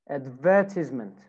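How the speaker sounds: British "advertisement", stressed on "VER"; British accent. Shown as a demonstration. Teacher models British "advertisement" for students, stress on second syllable. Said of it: "British accent".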